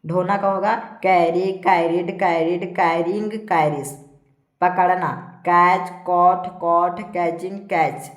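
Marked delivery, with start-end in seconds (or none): 1.0-3.9 evenly spaced list, firm first word; verb drill recitation. Shown as a demonstration. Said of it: "यहाँ पर इसको पढ़ेंगे". 5.4-8.1 firm, louder list reading that eases off at the end; evenly spaced classroom drill; verb drill recitation. Shown as a demonstration. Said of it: "इस प्रकार से सारा को पढ़ते जाना है".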